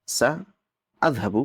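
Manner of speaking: very slow سأذهب with a clear glottal catch; sa'adhhab said slowly with the hamza sounded; hamza demo. Shown as a demonstration. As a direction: spell out سأذهب, hamza stopped cleanly. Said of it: "یہ حمزہ ہے".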